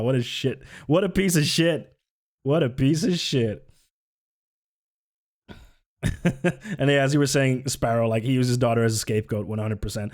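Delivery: ordinary delivery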